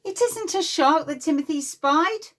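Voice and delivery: usual speaking voice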